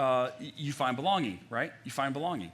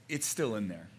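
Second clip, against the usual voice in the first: ordinary talk, nothing marked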